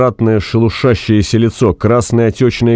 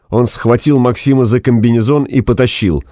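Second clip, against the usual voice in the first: usual speaking voice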